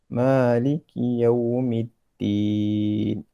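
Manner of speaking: slow, drawn-out tajwid recitation; slow Quran recitation. Shown as a demonstration. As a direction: chant each verse slowly and evenly. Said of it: "Kita akan baca surah Al-Fatihah". (bacaan tartil perlahan)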